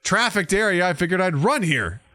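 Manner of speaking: hammed-up exasperation; mock defiant retort. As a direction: tell off the imagined truck guy. Imagined defiant reply to locals.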